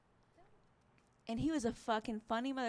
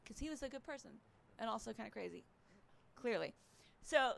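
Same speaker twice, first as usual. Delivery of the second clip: ordinary delivery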